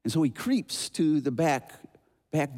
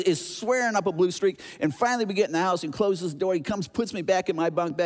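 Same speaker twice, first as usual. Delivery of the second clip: ordinary delivery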